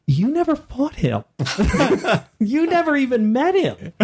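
laughing retort. Retold or imitated.

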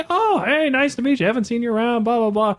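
overly polite small talk that winds down; mock cheerful small talk. [name] retells his own chirpy reply.

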